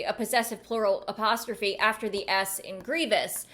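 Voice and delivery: usual speaking voice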